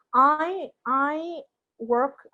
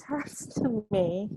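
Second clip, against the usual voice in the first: normal talking voice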